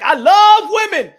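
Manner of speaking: high, drawn-out shouted declaration; loud and proud. Acted. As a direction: holler it, stretching LOVE. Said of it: "why you gotta say it like that".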